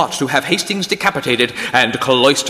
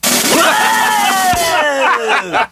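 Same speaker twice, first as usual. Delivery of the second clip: huge high whooping laugh; 13 semitones higher than usual. Real, not acted. Big laugh at the joke.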